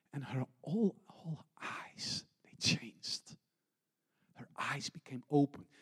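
breathy whispered hush as darkness leaves. Acted. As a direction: drop to a whisper, awed. Tender climax of healing story.

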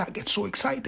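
ordinary talk

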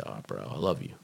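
low, soft mumbled imitation of his brother; low-voiced imitation of an addled brother. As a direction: drop low and quiet, imitating a stoned brother. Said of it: "this is how he talked".

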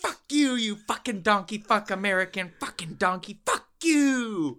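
high, mock screaming tirade in the fake accent; furious accented rant. The scammer raging at the caller for wasting his time, in a mock furious tirade. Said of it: "I heard his spirit break".

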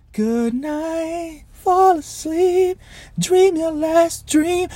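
a slow, hushed mock bedtime wish; mock lullaby. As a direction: coo it like tucking someone into bed, mockingly. Mocking a peaceful death in sleep.

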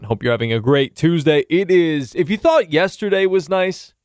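normal talking voice

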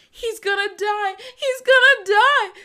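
high, loud, slow distraught wail; hysterical wailing. Acted. Deb breaking down to Karen, loud hysterical wail. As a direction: wail it like she's falling apart.

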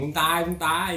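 mock shouted threat. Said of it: "พูดตะโกนว่า".

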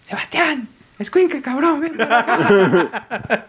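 high mock yell of an angry mother, drawn out at the end; a mother calling her son over, imitated high and slow; mom's typical yell. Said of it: "el muy típico grito de mi mamá". (imitación de mamá gritando, aguda y alargada)

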